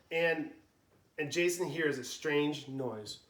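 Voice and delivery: ordinary delivery, nothing marked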